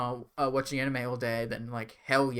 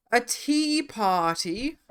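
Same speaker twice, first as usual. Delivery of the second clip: posh tea party voice; 9 semitones higher, much slower than usual. Pretend. Reading tier item in posh voice.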